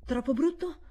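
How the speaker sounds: troppo brutto? rising in disbelief; surprised echo. Acted. Governess repeating the boy's words in surprise.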